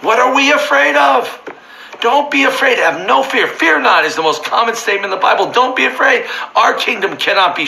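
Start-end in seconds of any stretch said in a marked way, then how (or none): none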